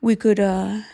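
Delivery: put-on sleazy old gentleman voice, partly whispered, drawn out slowly; creepy dandy voice. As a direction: play a leering old gent with a cane, purring compliments. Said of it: "he should be a guy that's going".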